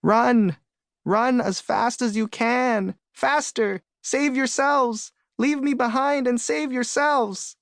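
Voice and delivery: high, panicked turtle scream. A narrator's reading. Turtle at the back urging the others to flee, screaming. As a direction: scream it high with long-held words. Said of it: "who screams".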